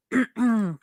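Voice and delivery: throat clear. Real, not acted. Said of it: "My throat's getting dry".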